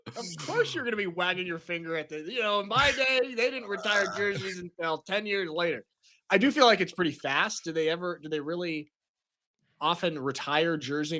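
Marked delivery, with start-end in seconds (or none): 2.2-5.8 mock grumpy old-timer, slightly higher; a hint of a crotchety old man lecturing. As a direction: wag your finger like a cranky old man. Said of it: "crotchety old man".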